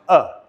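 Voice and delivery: uh as a short neutral vowel; schwa sound. Shown as a demonstration. Teacher showing students the schwa, a short neutral uh. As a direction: say uh as an unstressed schwa. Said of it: "The schwa is simply the sound".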